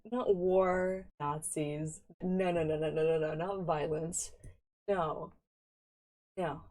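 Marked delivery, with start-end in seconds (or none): none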